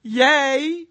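loud joyful cheer. Acted. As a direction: shout it like a happy crowd. Preacher cheers for rejoicing.

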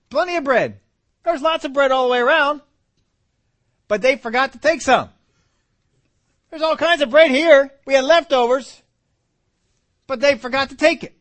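high, loud, fired-up preaching; excited preaching. Real, not acted. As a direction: let it build, voice climbing high and loud.

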